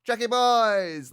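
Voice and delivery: fond imitation of a Spanish accent; mock Spanish accent. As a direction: lightly mimic her Spanish accent, drawing it out. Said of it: "in her Spanish accent, she says".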